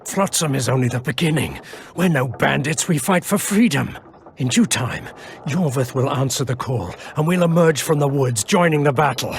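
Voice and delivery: rally the cause, growing to a cry; rising fervor. Acted. Wounded rebel elf proclaiming the coming battle, rising fervor.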